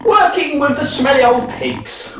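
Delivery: ordinary delivery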